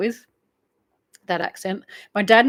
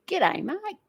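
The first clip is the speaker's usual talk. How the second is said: exaggerated nasal Australian twang, swooping high; broad Aussie accent; 10 semitones higher, 5 dB quieter than usual. Shown as a demonstration. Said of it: "but I don't say".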